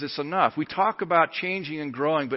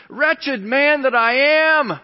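high, loud, drawn-out wail of despair; slow, anguished preacher's cry; 12 semitones higher, 9 dB louder, slower than usual. Acted. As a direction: cry it out from the pulpit, stretching each word. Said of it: "this cry of despair".

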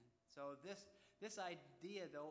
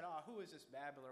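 loud mock scoff at a babbler; acted jeer, pushed louder; mocking sneer; 6 dB louder than usual. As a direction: scoff it out loud, acting a heckler. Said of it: "Some mocked and some sneered".

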